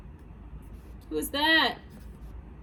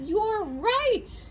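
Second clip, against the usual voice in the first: high-pitched hot dog in mock dismay; despairing hot dog; 8 semitones higher than usual. Hot dog admits defeat to the reader, high mock despair.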